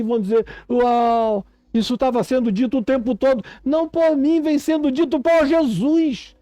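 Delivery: high, urgent pulpit delivery; impassioned preaching. Real, not acted. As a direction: build to a high, emphatic climax.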